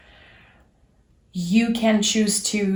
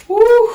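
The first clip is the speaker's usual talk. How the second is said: excited whoop; 12 dB louder than usual. Real, not acted. Reacting to a card draw.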